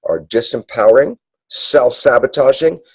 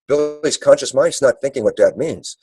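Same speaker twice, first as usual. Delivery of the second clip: usual speaking voice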